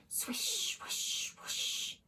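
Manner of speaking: soft unvoiced swishing; whispered swish. A narrator's reading. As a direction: breathe the swishes out. Whale tail sound.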